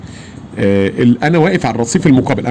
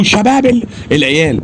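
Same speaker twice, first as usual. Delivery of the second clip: urgent pointing out; 9 semitones higher than usual. Retold or imitated. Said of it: "قلت له".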